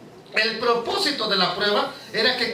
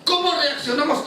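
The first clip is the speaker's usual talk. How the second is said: ordinary talk, nothing marked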